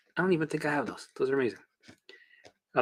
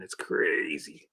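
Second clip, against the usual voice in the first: slow breathy whisper, aside; 8 semitones lower, 7 dB quieter, much slower than usual. Real, not acted. Coach to his stream, whispered aside. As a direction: let it drop to a whisper. Aside after smoke and mirrors.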